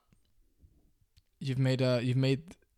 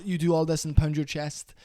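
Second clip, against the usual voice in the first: ordinary delivery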